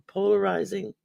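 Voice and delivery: usual speaking voice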